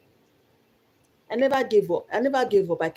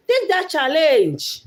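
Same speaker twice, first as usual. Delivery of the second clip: high, loud, drawn-out rallying call; emphatic urging; 7 semitones higher, 5 dB louder, much slower than usual. Real, not acted. Vlogger urging viewers to take that challenge, emphatic. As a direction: push it out slowly and forcefully to the audience.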